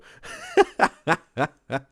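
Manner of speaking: loud, high-pitched cackling laughter; big laugh. Real, not acted. Reactor joking Martin looks like a bandit, high cackling laugh. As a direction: crack up in high, loud bursts.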